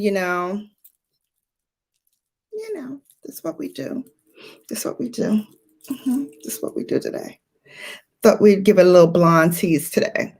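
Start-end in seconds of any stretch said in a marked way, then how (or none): 2.5-7.4 much softer, murmured to herself; hushed self-admiring. Real, not acted. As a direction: say it softly while admiring the hair. Admiring her hair, hushed.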